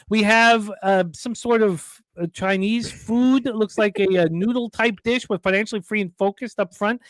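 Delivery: high excited race call; race announcer. Acted. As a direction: call the final stretch of the race. Calls the food race.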